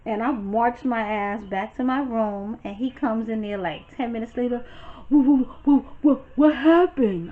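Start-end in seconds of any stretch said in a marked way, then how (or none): 5.1-7.3 high, louder panicked questioning; a flustered boyfriend rushing in; frantic Devon. Retold or imitated.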